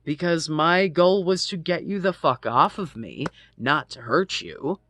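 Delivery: loud, high, indignant; real anger boiling over in the retelling; heated outrage. Real, not acted. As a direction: push it out hot and loud.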